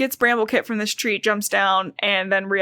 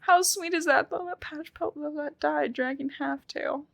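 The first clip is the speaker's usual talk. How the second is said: much softer, near tears; choked-up softness; 5 semitones higher, 8 dB quieter than usual. Real, not acted. Sad about the elder's death, softly choked to her co-host. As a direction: let the voice drop away as tears come.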